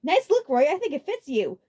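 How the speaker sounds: snickering. A narrator's reading. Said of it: "Larry snickered".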